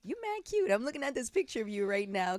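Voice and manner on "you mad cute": squealing high compliment; delighted. Real, not acted. Host gushes over guest's second grade picture, delighted. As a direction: gush over a cute photo. Host reacts to childhood photo.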